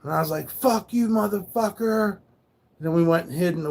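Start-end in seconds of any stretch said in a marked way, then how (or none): 0.5-2.2 retold defiant shout; yelling back. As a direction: fling it up high right back at them. Yelling back at screaming drivers.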